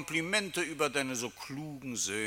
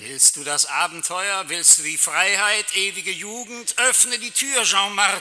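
higher, louder, rising temptation ending in a laugh; excited luring; 9 semitones higher, 8 dB louder than usual. Acted. Tempter lures Jean-Marc to open the door, gleeful. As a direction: sell him freedom with growing glee.

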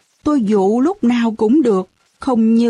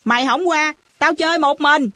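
mildly huffy, put-on threat; feigned sulk; 5 semitones higher than usual. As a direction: pretend to be offended and call it out. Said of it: "tôi giả vờ giận dỗi, nói lớn".

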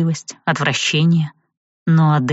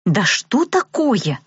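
gasping; 5 semitones higher, much slower than usual. A narrator's reading. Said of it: "охнула Рэй".

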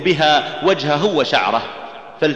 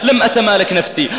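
usual speaking voice

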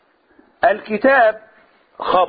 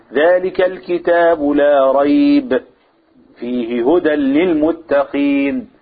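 slow recitation, pausing right after لا ريب before فيه هدى للمتقين; slow Quran reading with the stop placed after لا ريب; pause after لا ريب; 3 semitones lower, much slower than usual. Shown as a demonstration. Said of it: "أنا وقفت كده على إيه؟ على لا ريب".

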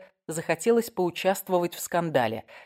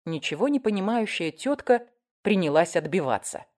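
plain reading voice

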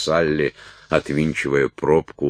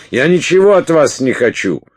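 high, loud, frantic protest; shouted refusal; 13 semitones higher, 8 dB louder than usual. A narrator's reading. Уилт rejects Салли's advances, frantic shouting. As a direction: cry out the refusal at full voice. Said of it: "закричал он".

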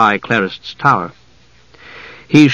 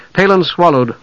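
usual speaking voice

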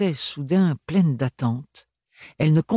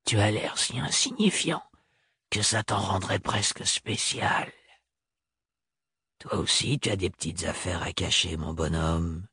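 softer, lower, murmured in the ear; murmuring; 5 semitones lower, 6 dB quieter than usual. Acted. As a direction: murmur it like a secret. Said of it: "lui murmurer quelque chose à l'oreille".

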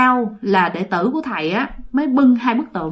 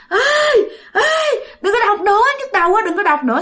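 much higher, fast pleading cry; pained plea; 11 semitones higher, much faster than usual. Retold or imitated. Ghost begging chanting monks to stop, pained high cry. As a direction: beg them to stop the chant.

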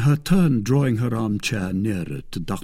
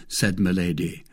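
ordinary delivery

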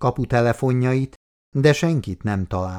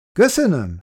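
higher, mildly raised call; calling back; 8 semitones higher, 3 dB louder than usual. A narrator's reading. Andrej thanks the man upstairs, calling back. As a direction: call your thanks up to a window. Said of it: "kiáltott vissza".

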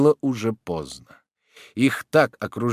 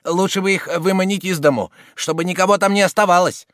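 raised, higher urging voice; insisting legalist; 8 semitones higher, 5 dB louder than usual. A narrator's reading. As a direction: press the point on the gang. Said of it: "настаивал законник".

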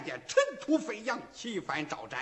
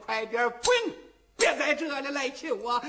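mildly raised, scolding dismissal; irritated, slightly higher-pitched order to leave; angry shout; 7 semitones higher than usual. A narrator's reading. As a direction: drive them out with a testy yell. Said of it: "喊声".

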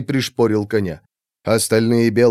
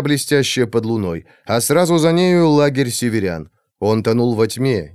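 ordinary delivery, nothing marked

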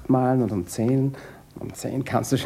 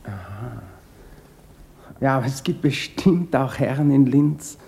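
ordinary delivery

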